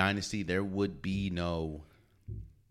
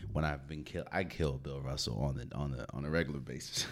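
ordinary talk, nothing marked

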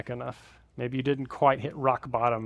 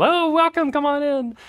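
high, loud gushing welcome; 13 semitones higher, 7 dB louder than usual. Retold or imitated. In-laws gushing at the door, high and loud. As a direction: greet him like long-lost family.

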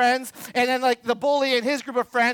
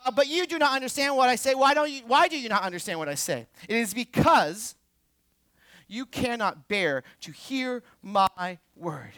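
usual speaking voice

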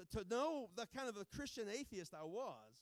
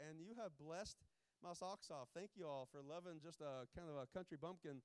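softer, heartfelt gratitude; sincere thanks; 6 semitones lower, 5 dB quieter than usual. Real, not acted. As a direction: thank them quietly from the heart.

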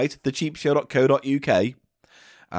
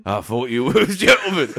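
loud offended outburst; 4 dB louder than usual. Pretend. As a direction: go back to a louder offended voice. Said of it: "voice has changed".

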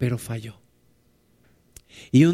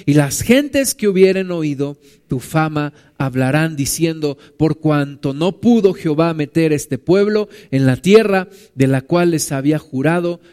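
louder, steady declaiming read of the verses; scripture reading; 5 dB louder than usual. Acted. Preacher reading Moses's plea from the Bible to the church. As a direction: read it out like a proclamation. Reads scripture aloud to congregation.

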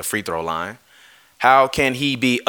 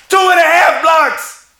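much higher, loud and slow, leaning on two; outraged shout; 7 dB louder, slower than usual. Real, not acted. Yelling the stat at viewers to make his point. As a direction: bellow the stat back in disbelief.

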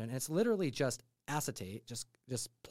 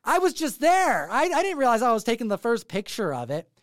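high, louder, exasperated self-defence; answering critics, defensive and exasperated; defensive protest; 8 semitones higher, 6 dB louder than usual. Retold or imitated. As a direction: protest your innocence. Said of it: "I'm over here going like".

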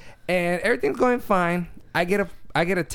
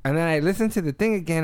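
usual speaking voice

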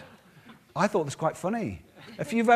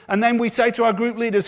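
usual speaking voice

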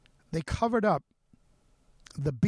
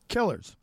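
"Killers" set apart, higher and falling sharply; stress on the plural; 4 semitones higher, 4 dB louder than usual. Real, not acted. Host insisting no one guy did this, stressing "Killers". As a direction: land hard on "Killers" as a one-word correction. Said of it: "S at the end".

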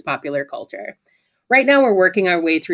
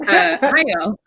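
talking like wearing braces; 8 semitones higher, slower than usual. Acted. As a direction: mouth stiff like braces. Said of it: "is this your, uh, braces impression?".

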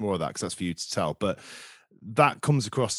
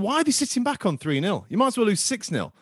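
fan's retold outburst, pitched up; exasperated rant; 11 semitones higher than usual. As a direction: vent at the lazy opposition. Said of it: "I said".

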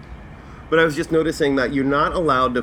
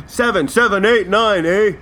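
mocking the TikTok guy; 8 semitones higher, 5 dB louder than usual. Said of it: "And it, it".

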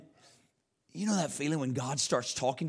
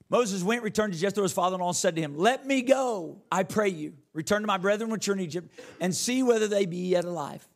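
raised, high-pitched preacher's reading; scripture reading; 8 semitones higher, 5 dB louder than usual. Acted. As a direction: proclaim the verse to the congregation. Reads scripture aloud to congregation.

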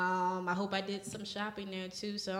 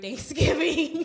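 louder, laughing mid-sentence; 8 semitones higher, 10 dB louder, slower than usual. Real, not acted. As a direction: break into a laugh mid-line. Laughs at own joke.

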